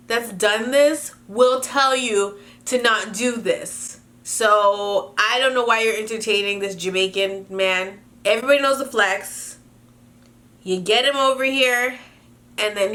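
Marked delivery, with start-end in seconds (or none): none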